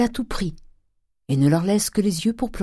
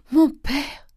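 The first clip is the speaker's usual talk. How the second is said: much higher, breaking sob on mon père; 12 semitones higher, 5 dB louder than usual. A narrator's reading. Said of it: "Les pleurs et les sanglots". (mon père, la voix qui se brise en sanglot)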